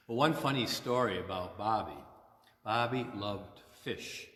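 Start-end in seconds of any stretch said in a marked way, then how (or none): none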